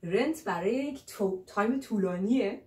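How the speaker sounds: normal talking voice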